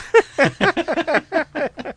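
high, long laugh; high-pitched giggling fit. Real, not acted. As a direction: burst out laughing at your own joke. Laughing at own joke's punchline.

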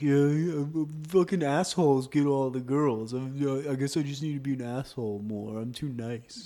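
a hint of a whiny nice guy; lightly mock the self-pitying nice guy. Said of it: "It's the classic nice guy".

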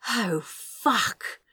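gasped cry. Acted. Gasping climax exclamation.

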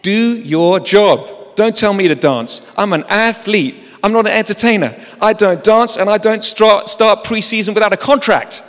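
hint of a proud athlete refusing; mild mock defiance; athlete's retort.

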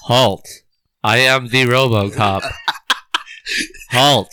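low, stiff robot cop voice; deep robotic halt command. Pretend. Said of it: "That's how you say it?".

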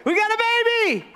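high-pitched hammed-up elation; excited. New parent announcing a baby, excited. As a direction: squeal the big news.

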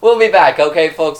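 high, loud host sign-off; announcer voice. Pretend. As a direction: wrap up the show, hammed-up. Mock sign-off to folks.

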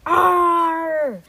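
long, high, loud scream. A narrator's reading. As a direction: scream out in mock terror. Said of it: "screamed as they leapt".

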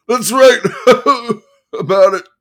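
high, loud yell broken by a laugh. Acted. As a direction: yell it, cracking up midway. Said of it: "he yelled".

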